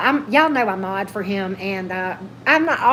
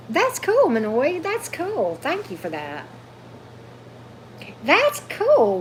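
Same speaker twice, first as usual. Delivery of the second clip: high, bright exclamation of delight; excited delight; 5 semitones higher, slower than usual. Real, not acted. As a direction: squeal it happily at the chat. Reacting to chat gifts.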